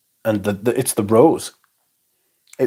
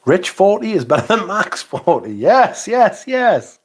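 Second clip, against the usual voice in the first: excited gushing; 9 semitones higher than usual. Real, not acted. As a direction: gush with delight, climbing up.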